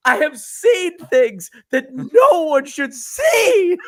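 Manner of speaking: high, slow, loud, half-laughing quaver; haunted Malkovich. Retold or imitated. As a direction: play a shaken man, laughing through it. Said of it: "he goes".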